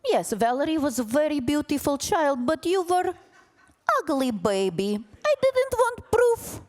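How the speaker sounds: high-pitched, hammed-up Ukrainian accent; Ukrainian mother impression. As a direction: imitate a blunt mother with a thick Ukrainian accent.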